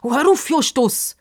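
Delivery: high, irritated protest; cross. A narrator's reading. Girl telling off her sidekick, cross. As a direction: snap at him to stop. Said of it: "sagt Pippa hässig".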